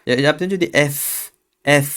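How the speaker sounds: ordinary delivery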